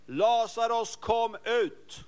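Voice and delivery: commanding call. Acted. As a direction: call Lazarus out of the grave. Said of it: "Han ropade".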